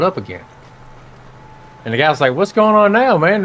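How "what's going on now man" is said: puzzled customer. Acted. Guy questions bartender in joke.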